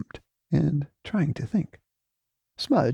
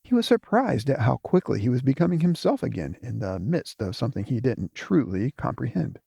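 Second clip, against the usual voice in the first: usual speaking voice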